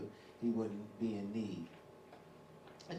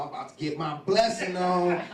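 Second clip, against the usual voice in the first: high, loud eager boast; excited son expecting reward; gleeful Esau; 6 semitones higher, 10 dB louder than usual. Retold or imitated. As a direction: crow about the coming blessing. Esau voiced gleefully in retelling.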